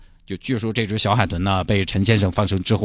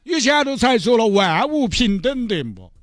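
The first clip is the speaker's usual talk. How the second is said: high, sing-song comic voices haggling over the dolphin; pleading buyer and gruff vendor. Acted. Mr Chen begging the fishmonger to free the dolphin, high hammed-up pleading.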